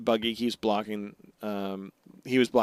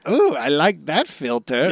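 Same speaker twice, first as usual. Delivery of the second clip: put-on delighted voice, slow and louder; Buggy; 5 semitones higher, 5 dB louder, slower than usual. Buggy admires the new filter on his broadcast, mock delight. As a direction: relish it like a preening showman.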